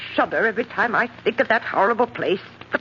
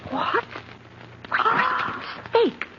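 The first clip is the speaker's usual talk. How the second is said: shocked disbelief; 4 dB quieter than usual. Acted. Shock at the house sale.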